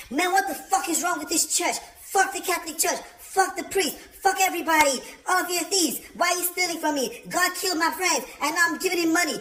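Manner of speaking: high, shouted furious outburst in church; loud angry rant. Retold or imitated. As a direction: yell it at the whole congregation. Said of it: "So his voice became louder".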